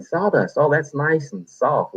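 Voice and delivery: high, slow mock voice of a naive recruit; naive listener. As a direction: play the gullible listener, drawn out slowly. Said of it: "And you're probably thinking".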